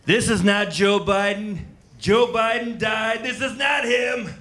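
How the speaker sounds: hammed-up Trump rant; Trump impression. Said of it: "Trump was like".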